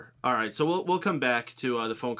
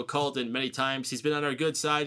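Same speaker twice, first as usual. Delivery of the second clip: normal talking voice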